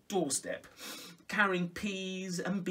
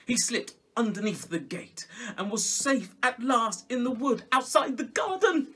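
louder, rising relief; triumphant escape; 7 semitones higher, 8 dB louder than usual. A narrator's reading. Storyteller celebrating Peter's escape loudly. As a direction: ring out the escape.

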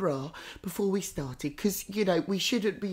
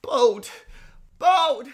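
louder and louder bald, rising high; spirit screaming; 3 semitones higher, 8 dB louder, much slower than usual. Retold or imitated. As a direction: call it out, building to a yell. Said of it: "he's screaming it loud and clear".

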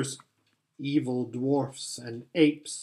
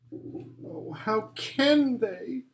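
tearful; 8 semitones higher, 3 dB quieter, much slower than usual. Acted. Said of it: "tears streaming down her cheeks".

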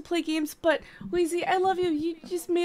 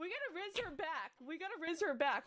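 much softer, hushed aside; 18 dB quieter than usual. Shown as a demonstration. Friends plot quietly to flirt back. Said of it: "Hold on".

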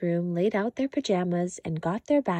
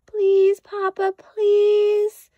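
put-on much higher, louder childish pleading; a little cub begging hard; begging cry; 12 semitones higher, 7 dB louder, much slower than usual. A narrator's reading. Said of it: "cried Sister".

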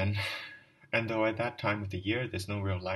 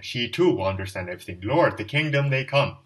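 rising cry; 9 dB louder than usual. Acted. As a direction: swell into a cry to the Lord. Climax of drunken speech.

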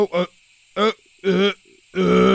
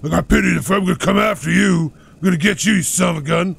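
gruff tough biker threat; 4 dB louder than usual. Retold or imitated. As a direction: play a menacing biker. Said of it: "I have a biker voice?".

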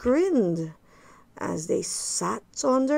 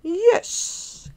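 cried out; 8 semitones higher than usual. A narrator's reading. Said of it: "cried the witch".

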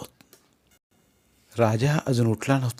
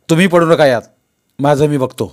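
louder, dismissive brush-off; contemptuous; 3 semitones higher, 9 dB louder than usual. Acted. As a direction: scorn the father's meddling. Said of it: "दादा तिरस्कारानं म्हणाला". (बापाला तिरस्काराने उडवून लावणारा मुलगा)

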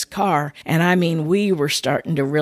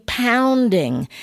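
POUNDING said higher, drawn out with force; emphatic repeat; 6 semitones higher than usual. Real, not acted. Storyteller stressing how her heart raced, emphatic POUNDING. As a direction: lift and lean hard on "pounding".

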